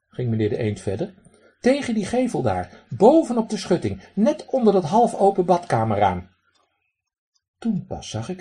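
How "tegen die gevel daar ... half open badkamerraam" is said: high, loud duck voice with a raspy quack. Acted. Drake lectures the man who threw pebbles, high raspy quack. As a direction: play a cheeky drake scolding a stranger.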